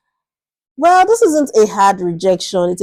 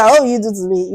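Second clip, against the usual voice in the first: ordinary delivery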